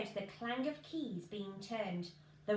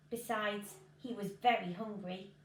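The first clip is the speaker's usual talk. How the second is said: ordinary delivery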